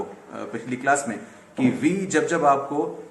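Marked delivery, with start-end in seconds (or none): none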